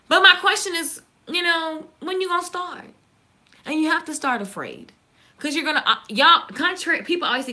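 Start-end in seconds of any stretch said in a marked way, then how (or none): none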